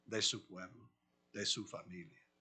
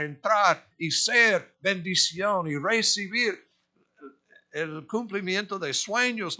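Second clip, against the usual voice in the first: loud, higher fervent preaching; preaching at full force; 6 semitones higher, 11 dB louder than usual. Acted. Preacher exhorting the congregation to die to self, loud and fervent. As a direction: drive it home like a revival preacher.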